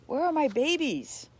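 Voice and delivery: frantic, desperate pleading. Acted. Mother frantically asking after her cubs. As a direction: cry out for lost babies in panic. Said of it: "she asked frantically".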